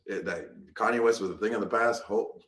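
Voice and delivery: ordinary talk, nothing marked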